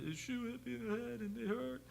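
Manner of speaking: put-on high whiny little-boy voice; imitating crying child. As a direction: mimic a hurt kid complaining to dad. Dad retells his crying son.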